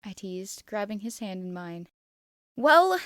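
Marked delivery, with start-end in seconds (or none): 2.5-3.0 loud, much higher flustered snap; irritated. Acted. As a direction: bark it out, flustered and cross. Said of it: "he said, irritated".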